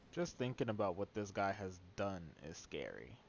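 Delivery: ordinary delivery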